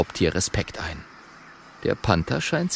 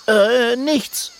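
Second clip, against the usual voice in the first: high, evasive denial; high-pitched flustered cover-up; hasty little dragon; 13 semitones higher than usual. A narrator's reading. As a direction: blurt it out as if caught. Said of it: "sagt Kokosnuss schnell".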